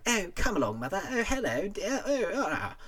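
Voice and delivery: mock posh British chatter, pitched high; posh British impression. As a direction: ham up a fussy posh British mother. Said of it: "everyone's like".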